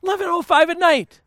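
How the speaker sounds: high-pitched disbelief; hammed-up protest at the hour; mock incredulity. Husband finding wife in kitchen late, high mock incredulity.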